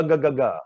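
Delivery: "ga, ga, ga" from the throat; consonant drill. Shown as a demonstration. Coach drilling throat consonants, crisp. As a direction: push each "ga" from the throat. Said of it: "in the throat is ga".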